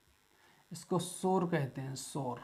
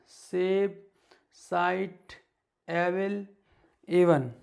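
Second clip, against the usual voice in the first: louder, each word called out clearly; read-along drill; 3 semitones higher, 5 dB louder than usual. Acted. Teacher leads the class reading word list aloud. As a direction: call out each word for students to repeat.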